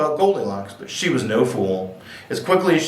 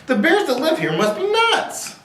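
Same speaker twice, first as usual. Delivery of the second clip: put-on high-pitched, incredulous little-girl complaint; exasperated outburst. A narrator's reading. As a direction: exclaim it high, rising to the last word. Exhausted girl's outburst in story.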